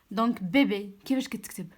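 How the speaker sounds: usual speaking voice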